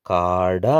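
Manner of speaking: കാട with a drawn-out aa; long vowel. Shown as a demonstration. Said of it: "ഒരുമിച്ച് വായിക്കാം". (കാട എന്നതിലെ ആ നീട്ടി)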